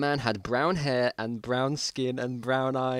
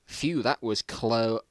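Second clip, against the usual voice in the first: normal talking voice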